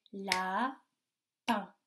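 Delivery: LAPIN as a long la, pause, pin; split into syllables. Shown as a demonstration. Teacher gives children a word, LAPIN split in two syllables. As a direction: break LAPIN into two separate beats. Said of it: "Il y a deux syllabes".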